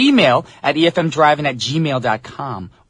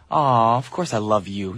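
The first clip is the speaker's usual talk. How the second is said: tender reply; 4 semitones lower than usual. Real, not acted. Host answering a devoted listener's tweet, tender and slow. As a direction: reassure a loyal fan gently and slowly. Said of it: "Brian, I love you. Do you love me?".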